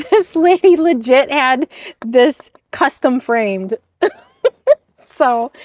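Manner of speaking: real giggles breaking into the sentence; laughing. Real, not acted. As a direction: barely get it out for laughing. Cracks up over framed joke.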